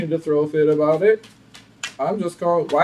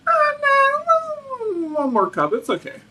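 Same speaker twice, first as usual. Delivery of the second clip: other person's retort; slower than usual. Retold or imitated. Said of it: "this other person is like".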